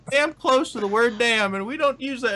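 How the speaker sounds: put-on high, wholesome 1950s kid; gee-whiz sitcom kid voice; Brady Bunch kid. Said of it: "it came off as very, like, Brady Bunch".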